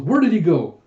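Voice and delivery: loud, exasperated question; bewildered shout. Acted. Huck jumps onto the street after Jaeha, bewildered shout.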